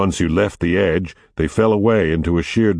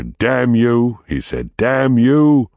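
angry cursing; 6 semitones higher, 4 dB louder, much slower than usual. Acted. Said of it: "Arthur cursed his brother".

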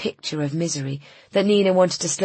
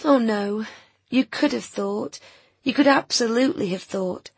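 high-voiced woman, mildly tearful; holding back tears; 7 semitones higher than usual. A narrator's reading. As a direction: reassure him while fighting back tears. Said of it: "trying not to cry".

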